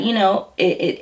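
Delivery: ordinary talk, nothing marked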